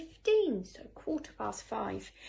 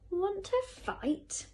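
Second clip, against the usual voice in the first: high, drawn-out challenge; bad-tempered ladybird spoiling for a fight; 10 semitones higher, 3 dB louder, slower than usual. A narrator's reading. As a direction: pick a fight, pitched up and slow. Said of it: "said the bad-tempered ladybird".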